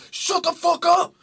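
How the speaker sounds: high, loud outburst; hammed-up furious singer shouting; mock angry yell. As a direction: snap back at the heckler like an offended artist. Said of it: "the lead singer yells at him".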